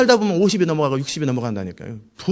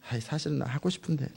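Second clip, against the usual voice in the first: much softer aside; sheepish admission; 11 dB quieter than usual. Acted. Believer admitting his own wish to God, sheepish. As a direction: confess it quietly, under the voice.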